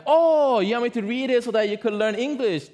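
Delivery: high, bright greeting at the door with a laugh; high-pitched friendly neighbor, laughing; cheerful neighbor. Retold or imitated. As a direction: greet her like a delighted American neighbor. Neighbor answers eagerly.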